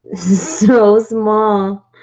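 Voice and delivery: slow, drawn-out marveling; overwhelmed by cuteness. Real, not acted. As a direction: stretch out so small in awe. Marveling at tiny piece.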